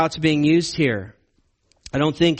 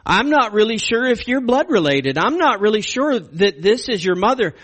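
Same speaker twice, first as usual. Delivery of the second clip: higher, louder scornful king; mock-angry father berating his son; voicing Saul's contempt; 5 semitones higher, 3 dB louder than usual. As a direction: sneer it at Jonathan like an angry father. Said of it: "He's basically saying".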